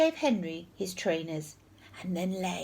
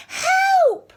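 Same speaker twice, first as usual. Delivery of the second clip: high, piercing cry for help; frantic shout; 10 dB louder than usual. A narrator's reading. Horrid Henry faking an emergency for his teacher, frantic shout. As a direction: yell it out in panic. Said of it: "shouted Horrid Henry".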